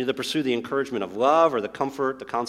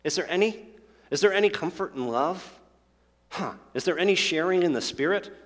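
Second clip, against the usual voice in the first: mock wondering; 6 semitones higher than usual. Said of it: "it's not like he's wondering".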